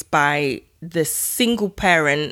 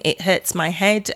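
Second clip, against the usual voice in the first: ordinary talk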